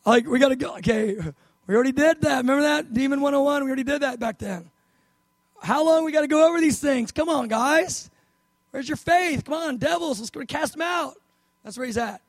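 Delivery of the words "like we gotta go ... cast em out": higher-pitched, fed-up pleading; exasperated. Acted. Jesus to his disciples who should know this already, exasperated. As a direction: chide them impatiently, pitched up. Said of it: "he is a little bit like".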